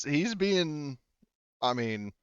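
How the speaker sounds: usual speaking voice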